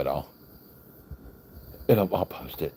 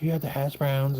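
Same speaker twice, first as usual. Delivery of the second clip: waitress voice; 9 semitones higher than usual. Retold or imitated. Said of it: "She's like".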